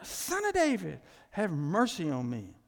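loud pleading cry to Jesus. Acted. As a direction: cry out with full force, begging. Preacher acts the blind man's cry.

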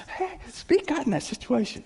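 imitated carefree cheer; happy pastor. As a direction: pipe up happily, imitating an upbeat pastor. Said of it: "He gets happy".

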